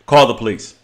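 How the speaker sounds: normal talking voice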